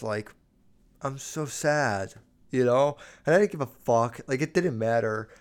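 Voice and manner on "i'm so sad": slow, softly faked sad voice; milked sadness. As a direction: play up being sad for sympathy. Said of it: "I really milked it".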